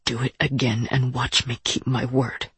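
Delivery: low, restrained threat; stern warning. A narrator's reading. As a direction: warn them in a low voice. Ursula answers with a threat.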